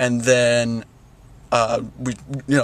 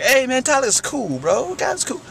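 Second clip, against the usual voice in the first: much higher, laid-back mock jive voice; jive guy; 11 semitones higher than usual. Clerk to the mother about Tyler, mock jive voice. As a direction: play the overly casual clerk. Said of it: "a real jive guy".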